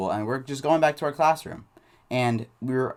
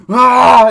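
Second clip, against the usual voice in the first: very loud, high "aah" yell; imitated rage scream; 11 semitones higher, 17 dB louder than usual. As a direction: burst out screaming as if lunging at someone. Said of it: "goes like this".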